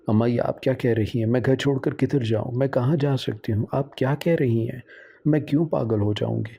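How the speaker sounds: ordinary delivery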